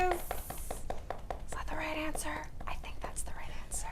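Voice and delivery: slow suspenseful whisper. Acted. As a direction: whisper it like a drumroll moment. Suspense after drum roll.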